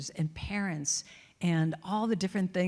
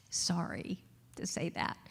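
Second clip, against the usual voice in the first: whispered apology; 2 semitones lower, 8 dB quieter than usual. Real, not acted. Aside after bad news.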